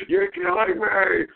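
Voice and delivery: very high, drawn-out whine; slow, high, hammed-up exasperation; exasperated wail. Real, not acted. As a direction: wail it slowly in despair at the dog. Pleading with disobedient dog, escalating.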